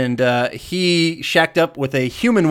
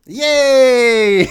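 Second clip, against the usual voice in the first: high, drawn-out "yay"; loud, long whoop of delight; excited cheer; 9 semitones higher, 6 dB louder than usual. Real, not acted. Host cheering that co-host is excited too, excited cheer.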